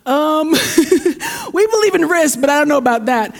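a laughing squeal of mock protest; playful disbelief, acted out; mock alarmed reaction. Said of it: "kinda had a few of us going".

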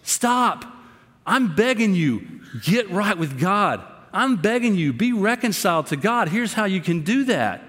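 heartfelt begging, close to tears. Retold or imitated. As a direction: beg them to turn back. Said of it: "That might require a tear on my part".

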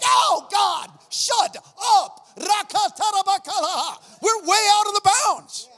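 high, loud, alarmed outcry; panicked shout. Retold or imitated. Panicked inner cry at the meeting.

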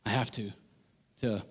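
weary low resignation; reluctant sigh. Retold or imitated. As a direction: drag it out like a chore. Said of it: "you go from".

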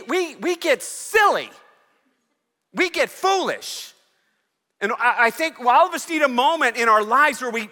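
high, loud, ringing preacher's call; preacher at full cry, pleading with the church; full-force preaching. Acted. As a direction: lift the whole room with it.

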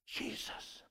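breathy whisper of the name. Acted. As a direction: let it fall to a whisper. Soft repeat after shouts.

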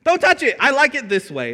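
put-on childish refusal. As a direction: shout it like a toddler guarding a toy. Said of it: "But we're just like".

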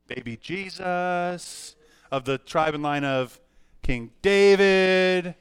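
very slow, stretched names; drawn-out answer. Real, not acted. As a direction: stretch each name out playfully long. Drawn-out answer to his own question.

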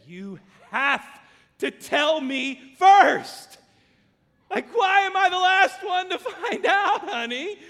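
hammed-up loud wife, half laughing; exasperated wife. Wife scolding husband for not telling her first, loud and high. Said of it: "in the most sweet, spirit-filled, loving way my wife ever could".

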